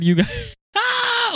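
laughing. Real, not acted. Laughing outburst at the story.

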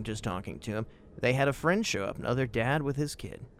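usual speaking voice

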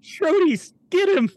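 high-pitched mock yell to pets; yelling a command. Player siccing pets on the big cat, high mock yell. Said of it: "And yells".

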